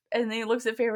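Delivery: ordinary talk, nothing marked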